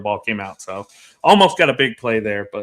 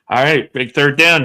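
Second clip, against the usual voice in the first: ordinary talk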